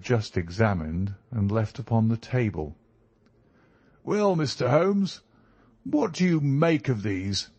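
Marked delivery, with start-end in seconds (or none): 4.0-7.5 higher-pitched country gentleman visitor; eager visitor's cry. A narrator's reading. Visitor to Holmes about the curious paper, crying out eagerly. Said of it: "he cried".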